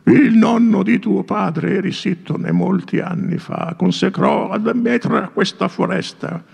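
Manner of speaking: put-on high, solemn old man's voice; old priest warning the feasting prince. A narrator's reading. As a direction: warn like an aged priest. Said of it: "e disse il vecchio".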